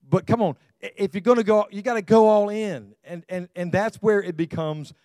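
preaching shout. Real, not acted. Pastor urging congregation.

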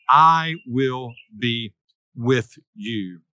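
very slow, word by word, sinking at the end; measured, weighty reassurance that settles low; solemn divine promise. Acted. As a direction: pronounce God's promise slowly, with pauses. God's message, quoted slowly for weight.

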